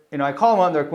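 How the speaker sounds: ordinary talk, nothing marked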